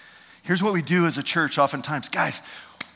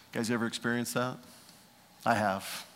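ordinary delivery

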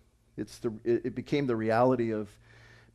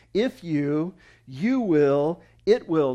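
"you will, it will" punched out loud in separate beats; emphatic; 2 semitones higher, 6 dB louder, much slower than usual. Retold or imitated. Preacher pointing the congregation to Jesus' wording, emphatic. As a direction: hit YOU and WILL hard, with pauses. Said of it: "Jesus uses very emphatic language here".

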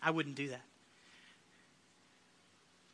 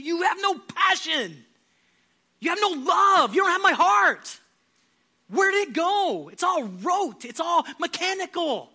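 rebuke; 7 semitones higher, 5 dB louder than usual. Acted. Jesus rebuking the church.

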